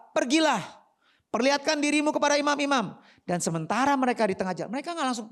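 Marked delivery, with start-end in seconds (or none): 0.1-3.0 loud, raised command. Acted. Jesus sending the lepers to the priests, loud command. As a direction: send them off in a high, forceful voice.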